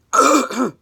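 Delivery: loud, high, harsh cough; sick cough. Real, not acted. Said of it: "that's my sick voice".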